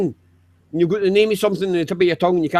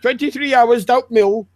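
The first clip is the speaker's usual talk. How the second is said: hammed-up Yorkshire rant; 3 semitones higher than usual. Hammed-up northern poverty rant.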